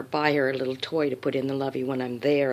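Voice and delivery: usual speaking voice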